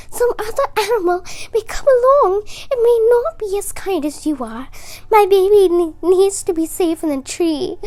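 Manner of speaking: high, fast pleading rush; pleading mother bird. A narrator's reading. Mother robin begs Squirrel to save her baby, high fast plea. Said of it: "the mother bird begged".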